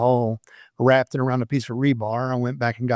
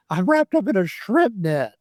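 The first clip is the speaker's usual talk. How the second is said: much higher, mock-whiny plea; whiny protest; 8 semitones higher than usual. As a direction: squeak it out like a wronged rookie. Pleading with dive commander, retold.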